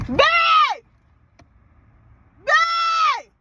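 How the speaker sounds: loud high call; yelling for babe, high and loud. Real, not acted. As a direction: shout for babe from a distance. Calling her partner.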